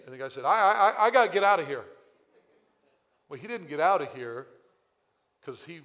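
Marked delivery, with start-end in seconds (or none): 0.4-1.9 high, loud, rushed stammering panic; panicked stammer. Retold or imitated. Stranger flustered by Richie's approach, panicked loud stammer. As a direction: blurt it like a cornered stranger desperate to leave.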